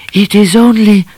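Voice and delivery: high, loud cat voice breaking off; Cat's voice. A narrator's reading. Cat starts to tell Miss Laura, high cat voice cut off. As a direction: blurt it, then stop short.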